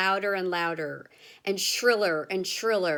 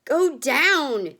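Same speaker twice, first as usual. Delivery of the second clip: loud, higher shouted order; yelling; 7 semitones higher, 8 dB louder than usual. A narrator's reading. Grandpa shouting over the roaring wind, loud yell. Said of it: "yelled Grandpa George".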